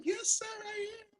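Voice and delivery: high, proud "Yes, sir"; proud put-on formal voice. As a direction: answer like a proud grown-up. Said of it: "I said".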